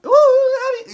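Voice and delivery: high, loud, sassy diva coo; a high, loud, sassy "ooh, honey" coo; mock Nikki Bella. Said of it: "Now she just sounds like Nikki Bella. But more like".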